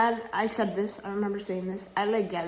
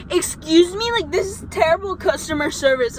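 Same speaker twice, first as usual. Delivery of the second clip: high, loud whiny complaint, hammed-up; mocking the customer; 8 semitones higher, 6 dB louder than usual. Retelling the rude customer's complaint, mock whiny high voice. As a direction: lightly mimic an entitled customer. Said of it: "And he goes like".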